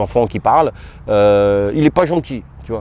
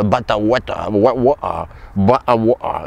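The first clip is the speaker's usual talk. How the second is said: mock English accent. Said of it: "accent anglais".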